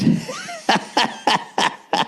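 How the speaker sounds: breathy, high burst of laughter; helpless wheezing laughter; wheezy laugh. Real, not acted. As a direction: crack up at your own story. Laughs at his own story.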